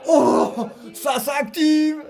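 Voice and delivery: slow, drawn-out hyped shout; excited shout. Real, not acted. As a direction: call out the rush of play. Co-commentator hyped by the attack.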